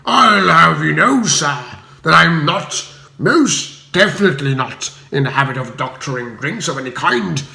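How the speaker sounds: haughty assertive. A narrator's reading. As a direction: try to sound tough. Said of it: "trying to sound assertive".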